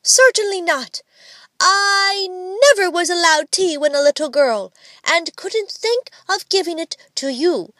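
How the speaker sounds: high, emphatic motherly no; high-pitched righteous reproof; prim refusal. A narrator's reading. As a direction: refuse like a lofty strict mother. Child playing mother refuses tea.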